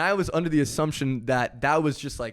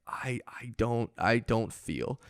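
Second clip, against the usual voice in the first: slow, soft, dazed mumble; barely there after fainting; 3 semitones lower, 5 dB quieter, slower than usual. Retold or imitated. Fainted donor answering the principal, slow and dazed. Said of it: "I'm dazed and confused".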